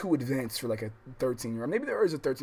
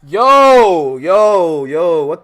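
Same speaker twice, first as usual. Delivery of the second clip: screaming loud and much higher in disbelief; real shocked screaming; shocked yell; 6 semitones higher, 21 dB louder, much slower than usual. Real, not acted. Shocked reaction to a game scene.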